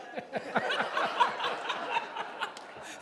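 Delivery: breathless high-pitched laugh; helpless silent wheeze; real laughter squeaking out; high wheezing laugh. Real, not acted. Laughing at his own story.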